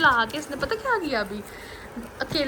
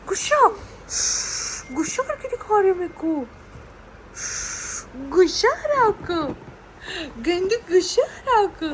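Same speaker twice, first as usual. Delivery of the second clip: mock scolding; 5 semitones higher than usual. Mother teasing baby about being angry.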